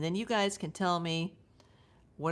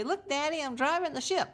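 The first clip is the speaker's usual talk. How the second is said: put-on higher, eager child at the helm; little kid voice; 8 semitones higher than usual. Little girl calling to her dad from a warship helm, higher and eager. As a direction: play the proud little kid showing off to Daddy. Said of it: "when I was a little kid with the Navy and say".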